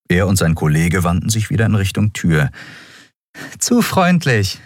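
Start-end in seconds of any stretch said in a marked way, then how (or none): 3.6-4.6 slow, high and sickly sweet; mock-gracious thanks, slowly; sugary sweet. A narrator's reading. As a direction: smile it out with fake sweetness. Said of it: "lächelte zuckersüß".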